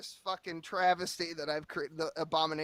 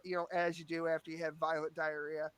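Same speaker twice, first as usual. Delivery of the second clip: ordinary talk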